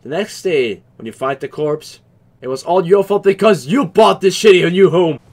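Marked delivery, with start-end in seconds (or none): 2.4-5.2 louder, higher playful accusation; loud, hammed-up blame; mock blaming outburst. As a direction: scold the parents in mock outrage. Blaming parents for his imagined death.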